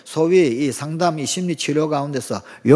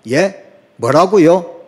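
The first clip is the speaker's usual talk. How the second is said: didn't-catch-it reaction; 3 semitones higher, 9 dB louder, much slower than usual. Retold or imitated. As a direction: ask loudly as if you misheard someone. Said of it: "우리는 어떻게 반응합니까?". (못 알아듣고 되묻는 말투)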